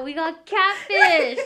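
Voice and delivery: slow laughing oh no, words stretched; laughing dismay. Retold or imitated. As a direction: laugh it out in mock horror. Mock dismay at catfish teacher.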